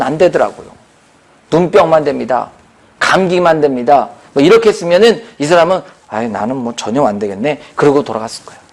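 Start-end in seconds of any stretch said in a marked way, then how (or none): 6.1-7.6 low, soft mock resignation; mild discouragement. Listener who feels left out of healing, mildly discouraged. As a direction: give up under your breath, lightly acted.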